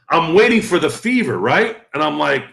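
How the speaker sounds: ordinary talk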